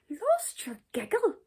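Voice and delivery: high, breathy question of disbelief; bewildered. A narrator's reading. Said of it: "he asked in bewilderment".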